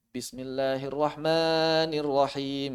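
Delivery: slow Quranic chanting with long vowels; drawn-out recitation. Shown as a demonstration. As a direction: recite each syllable stretched out.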